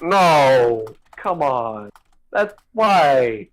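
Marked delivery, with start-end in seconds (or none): none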